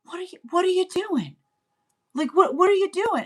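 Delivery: mock scold a child who got it wrong; exasperated parent.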